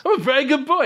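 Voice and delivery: very high, delighted talking-dog voice; Sergeant Pupper. Acted. Dog cheering up as tail wags, squeaky happy declaration. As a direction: squeal it with growing doggy joy. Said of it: "his tail is, like, wagging".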